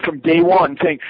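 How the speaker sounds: ordinary talk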